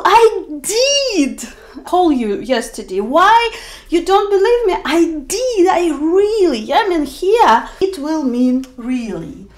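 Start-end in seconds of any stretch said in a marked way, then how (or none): 0.0-6.7 higher, insisting, "did" stressed; emphatic did. Pretend. Caller protesting to someone who doubts them. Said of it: "Or for example".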